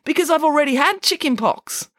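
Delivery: put-on high-pitched, cheerful child; pleased as can be; happy. A narrator's reading. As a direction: explain it happily, pitch up high. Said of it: "said Michael happily".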